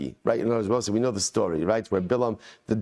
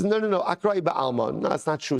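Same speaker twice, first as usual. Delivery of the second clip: higher-pitched quick denial; protesting Bilam; 6 semitones higher than usual. Acted. Bilam contradicting his donkey's complaint, protesting. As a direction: push back like a cornered owner. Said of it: "Bilam says".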